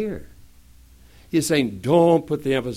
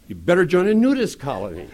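ordinary talk, nothing marked

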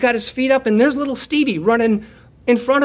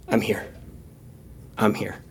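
soft reassurance; 12 semitones lower, 9 dB quieter than usual. Acted. Jesus quietly reassuring the preacher, softly lowered. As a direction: lower and softer, gently reassuring.

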